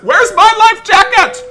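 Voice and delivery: shrieking for a missing life jacket; panicked scream. Acted. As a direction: scream it like you can't find it.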